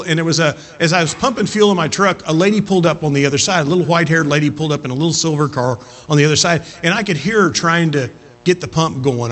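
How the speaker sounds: ordinary delivery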